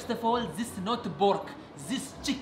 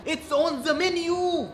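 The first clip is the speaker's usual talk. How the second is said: exasperated shout; 9 semitones higher, 5 dB louder, slower than usual. Acted. Waiter loses patience with customer.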